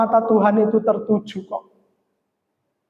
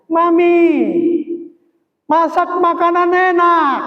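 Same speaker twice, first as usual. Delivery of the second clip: high, drawn-out cry to mum; acted shout, pitched high and stretched; imitated child's yell; 9 semitones higher, 3 dB louder, slower than usual. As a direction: yell like a fed-up child demanding food. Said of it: "anak saya yang nomor dua itu teriak-teriak".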